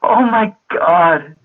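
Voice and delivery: high, drawn-out, weak from laughing; real tears of laughter, loud and wailing; tearful laughter. Real, not acted. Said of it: "these are tears of just laughter".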